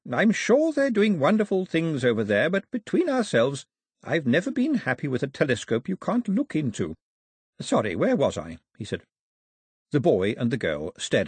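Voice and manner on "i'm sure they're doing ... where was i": put-on old man voice. A narrator's reading.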